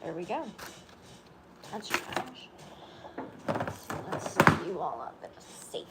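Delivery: slow whisper to a pet; soft slow whisper while tucking a pet in. Real, not acted. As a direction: hush it as you settle the animal down. Settling the pet down.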